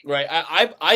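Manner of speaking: ordinary delivery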